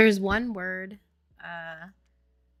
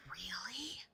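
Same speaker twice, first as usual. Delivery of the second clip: whispered, rising question; hushed little "really?" tipping upward; retold coy reply; 6 semitones higher, 17 dB quieter than usual. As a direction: whisper it back with mock modesty. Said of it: "I'm like".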